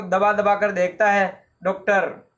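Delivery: plain reading voice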